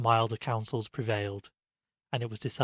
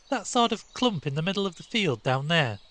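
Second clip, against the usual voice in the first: a hint of an eager boy, pitched up; lightly voiced curious schoolboy; 7 semitones higher, 4 dB louder than usual. A narrator's reading. Stanley asking his friends about the clump, curious. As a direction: point it out, curious and keen.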